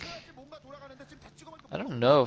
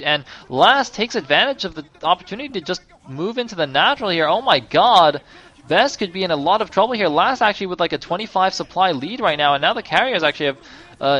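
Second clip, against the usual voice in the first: higher and louder hype, building to a peak; excited casting; 7 semitones higher, 5 dB louder than usual. Real, not acted. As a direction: ride the big moment as the fight breaks. Hype build during decisive fight.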